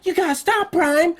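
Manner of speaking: hammed-up high plea to an evil robot; pleading Mark. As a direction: whine at the robot to calm down. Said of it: "he's like".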